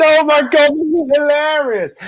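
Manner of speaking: high, loud mock amazement; hammed-up delighted exclamation; imitated audience delight. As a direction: squeal it like a crowd in stitches. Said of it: "the whole audience is going".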